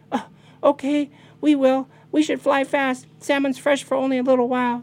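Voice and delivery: very high, loud, eager voices; high-pitched obedient birds; little bird grandchildren. Acted. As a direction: chirp agreement and hurry off.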